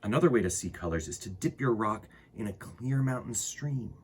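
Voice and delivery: put-on unhurried read-aloud for kids; storybook reading. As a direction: read it like storytime to little ones. Reads a children's book aloud.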